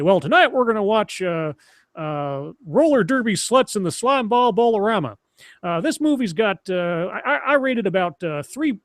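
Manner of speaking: hammed-up Texas twang introducing the late-night movie. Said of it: "Texas twang accent".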